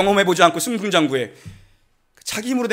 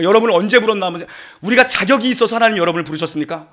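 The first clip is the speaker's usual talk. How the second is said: usual speaking voice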